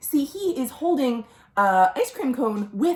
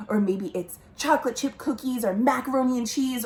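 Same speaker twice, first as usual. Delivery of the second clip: ordinary delivery, nothing marked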